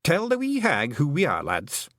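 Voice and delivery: put-on high, lilting Scots-accented little man; a cheeky tiny clansman owning up; wee Scottish Feegle. Feegle chief confessing to the young witch, high Scots voice.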